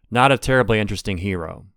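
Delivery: ordinary delivery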